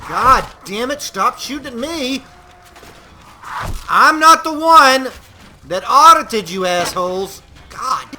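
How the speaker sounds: furious high shout at the enemies; real exasperated yell; angry yelling. Real, not acted. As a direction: bellow it, fed up with being shot at. Yelling at enemies shooting him.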